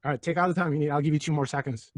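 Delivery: usual speaking voice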